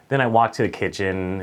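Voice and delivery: usual speaking voice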